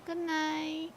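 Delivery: high, drawn-out good night; singsong farewell. Real, not acted. Host bids a departing viewer good night, singsong. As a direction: sing it out softly and high, holding the last note.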